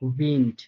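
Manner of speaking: German Wind with the final d devoiced to t; final d as light t. Shown as a demonstration. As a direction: end উইন্ড with a light ট. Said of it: "পুরোপুরি ঢ না বলে হালকা ট বলতেছি".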